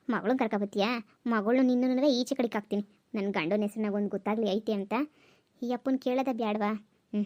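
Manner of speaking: put-on low, hushed old-woman voice; scheming mother-in-law. Crafty mother-in-law muttering her plans about the daughter-in-law, low and hushed. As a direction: plot under your breath like a crafty mother-in-law.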